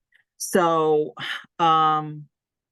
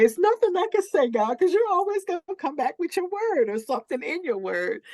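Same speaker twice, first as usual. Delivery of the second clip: exasperated whine; 13 semitones higher than usual. Retold or imitated. Exasperated plea to God.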